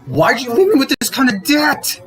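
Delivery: pitched high, pleading and accusing; a widow crying out at her dead husband; exasperated reproach to the dead. Retold or imitated. Widow to her dead husband over the debt, high accusing cry.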